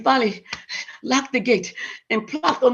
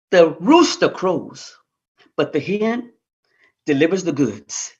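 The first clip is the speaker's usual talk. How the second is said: proverb moral; 6 semitones lower than usual. A narrator's reading. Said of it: "moral proverb".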